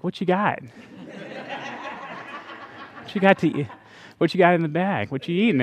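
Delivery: soft, quick pestering questions; a hint of a hungry kid nagging; imitating eager daughter. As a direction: daughter pestering her dad for food, softly. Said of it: "she's always like".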